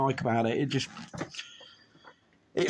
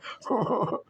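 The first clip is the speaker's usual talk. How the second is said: high laugh; 10 semitones higher than usual. Real, not acted. Laughs at own impression.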